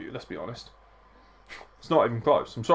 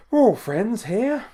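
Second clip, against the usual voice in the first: high, loud, drawn-out mock singsong; high playful voice; 11 semitones higher, 8 dB louder, slower than usual. Host reassuring viewers in the stream chat, playful high voice. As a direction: stretch it out slowly, pitched way up.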